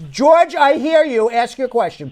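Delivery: calling out over a bad line; loud, slow and high to be heard. Real, not acted. Host raising voice over bad line.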